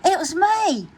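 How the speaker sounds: high cheeky admission; owning up. Acted. Grumpy Toad admits to Pete, cheeky. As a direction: confess with a cheeky grin.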